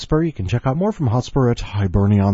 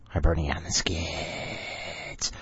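dramatic whisper; 3 semitones lower, 7 dB quieter, slower than usual. Pretend. Slow dramatic whisper of title.

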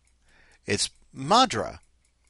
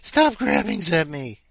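loud, higher, slow mock protest; paranoid cat; 5 semitones higher, 8 dB louder than usual. Owner speaking for the hunched, grabbed cat, hammed-up protest. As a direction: voice a pet that wants to be left alone. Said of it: "she's all hunched over and paranoid now".

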